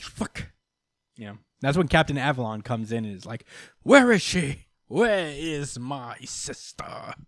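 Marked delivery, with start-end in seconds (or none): 0.0-0.5 hushed, voiceless mock swearing; whispered curse. Betsy running away from her niece, whispered curse. 3.8-7.1 high, loud demand slowing into drawn-out drama; intense Captain Avalon. Retold or imitated. Brother storms in looking for his sister, hammed-up demand. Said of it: "Oh, they go so hard".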